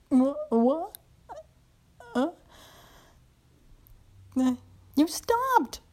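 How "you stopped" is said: much higher and louder, frustrated cry; indignant outburst. Acted. Woman protesting when her master stops, high frustrated cry. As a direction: yelp it, robbed of her pleasure.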